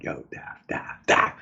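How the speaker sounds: very soft, low, partly whispered; imitated under-the-breath nudge; hushed call. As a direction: get a coworker's attention on the sly. Discreetly getting a neighbour's attention.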